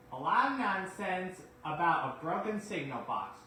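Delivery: loud grumbling complaint. Acted. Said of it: "grumbled Gordon".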